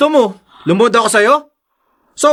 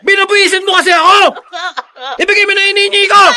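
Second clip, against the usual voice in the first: raised, higher angry demand; angry yell; 9 semitones higher than usual. Acted. Husband yells at wife to hand over money, angry. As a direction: bark the demand at her.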